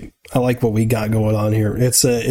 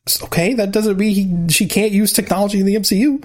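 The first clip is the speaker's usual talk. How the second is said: high squeaky know-it-all argument; squeaky bratty voice; 8 semitones higher than usual. Pretend. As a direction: lecture like a bratty kid. Mock bickering, squeaky voice.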